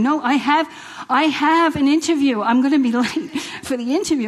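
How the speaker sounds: mock protesting. Woman retelling being frisked by police, protesting. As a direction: protest your innocence, half laughing.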